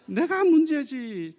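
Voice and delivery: loud, high, drawn-out declaration; emphatic admission. Real, not acted. Preacher telling the congregation who is at fault, emphatic. Preacher drives home the answer.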